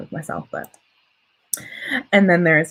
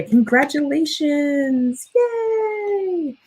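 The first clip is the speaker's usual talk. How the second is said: higher, slow stretched congratulations then squeal; higher, slow stretched cheer for a viewer; 10 semitones higher, much slower than usual. Real, not acted. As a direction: cheer them on with delight. Cheering a viewer's word count.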